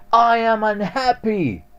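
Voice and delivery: high, loud theatrical moan of I am unhappy; dramatic complaint. Shown as a demonstration. Teacher mocks the victim phrase, high loud dramatic whine. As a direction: wail I am unhappy like a drama queen. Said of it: "there's a dramatic difference between saying".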